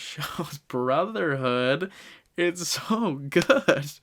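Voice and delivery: slow, laughing praise. Real, not acted. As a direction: gush through a laugh. Laughing, overcome with emotion.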